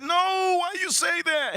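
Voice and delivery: very high, drawn-out squeal; mock wailing protest. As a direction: imitate a friend wailing in protest, slowly. Repeats friend's alarmed protest.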